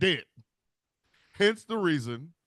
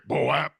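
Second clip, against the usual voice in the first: sharp falling-rising "Boap" call; boap bird call; 3 semitones lower, 5 dB louder than usual. Pretend.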